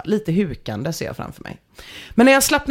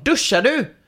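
mild mock call; 7 semitones higher than usual. Girlfriend calling from outside the bathroom, mild mock call. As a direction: lightly act out calling through a door. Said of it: "Då hör jag tjejen ropa utifrån".

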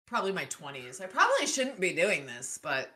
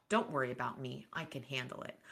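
put-on sulky child; 7 semitones lower, 10 dB quieter than usual. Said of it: "Like she's a fucking child".

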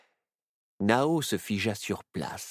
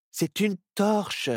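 slow, higher retort; 13 semitones higher, 3 dB louder, slower than usual. A narrator's reading. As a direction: correct him, slowly and pointedly. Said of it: "lui rétorqua-t-elle".

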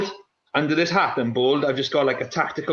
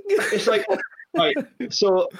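laughing; 10 semitones higher than usual. Real, not acted. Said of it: "I'm crying".

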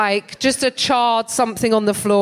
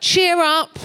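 catcalling stranger; 9 semitones higher than usual. Retold or imitated. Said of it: "I've started saying".

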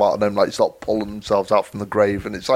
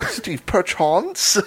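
slow, much higher, playful repeat; drawn-out high echo; 9 semitones higher, much slower than usual. Pretend. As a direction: stretch it out high and teasing. Playful mock repeat of line.

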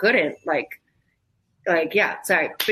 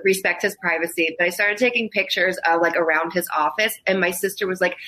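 ordinary talk, nothing marked

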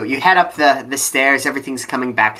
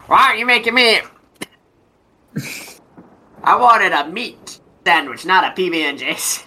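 creaky greedy chomping chest; 9 semitones higher than usual. Acted. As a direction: bark it like a greedy grumpy dad. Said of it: "I'm trying to mimic his voice right now".

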